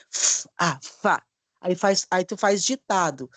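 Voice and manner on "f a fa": F as long hiss, then a, then fa; blending syllables. Shown as a demonstration. Teacher joining syllables, F held then blended to fa. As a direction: stretch the F sound and join it into fa. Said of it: "já vai poder juntar as sílabas".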